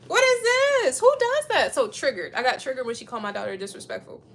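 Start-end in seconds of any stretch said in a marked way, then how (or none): 0.1-1.7 high, loud disbelief; outraged. Real, not acted. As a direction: exclaim it in indignant disbelief. Outrage at "yes ma'am" rule.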